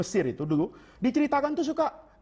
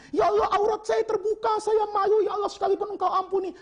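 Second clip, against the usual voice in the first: much higher, mocking whine of the teacher's prayer; mocking imitation; 10 semitones higher than usual. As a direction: parody the prayer as if it sounds silly. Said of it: "Muridnya yang satu bilang".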